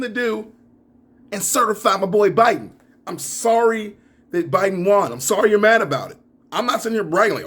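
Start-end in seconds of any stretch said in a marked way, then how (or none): none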